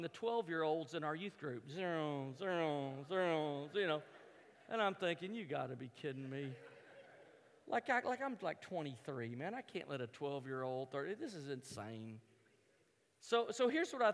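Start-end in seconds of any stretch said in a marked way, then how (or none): 1.7-3.7 low imitated whoosh of passing skiers; zooming noises. Lodge watcher imitating twelve-year-olds skiing past, low zooming noises. 5.3-12.2 much lower, softer grumble to oneself; muttered disbelief. Retold or imitated. Beaten skier to himself watching twelve-year-olds, low muttered disbelief.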